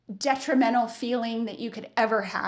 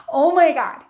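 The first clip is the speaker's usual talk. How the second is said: louder, laughing Oh my God; laughing exclamation; 4 semitones higher, 8 dB louder than usual. Real, not acted. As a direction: laugh it out in real disbelief. Laughing recalling the disaster.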